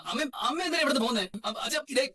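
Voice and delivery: high-pitched, agitated appeal; distressed protest. Acted. Son protests as mother threatens to leave home, distressed protest. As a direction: plead with rising alarm.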